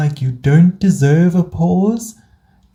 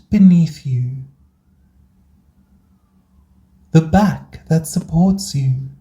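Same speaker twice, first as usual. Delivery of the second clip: guided meditation. Acted. Host guiding burnt-out listeners into rest, calm meditation voice. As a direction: guide them gently through each breath, unhurried.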